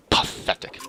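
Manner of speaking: breathy scornful whisper; whispered sneer. Acted. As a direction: spit it out in contempt. Sneering at the caravan.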